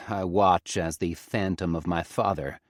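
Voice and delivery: usual speaking voice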